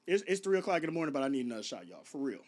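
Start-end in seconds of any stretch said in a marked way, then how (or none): none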